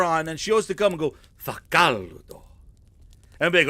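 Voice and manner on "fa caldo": lower, slow Italian "Fa caldo"; Italian teacher. Retold or imitated. Mimicking his Italian teacher complaining about the heat, low and slow. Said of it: "That's my Italian teacher used to do it".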